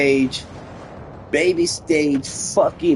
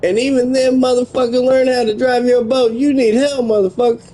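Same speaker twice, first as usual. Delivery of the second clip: much higher, louder heated rant; real gamer rage, much higher and louder; angry yelling; 10 semitones higher, 5 dB louder, faster than usual. Real, not acted. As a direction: chew him out at full volume. Raging at game opponent.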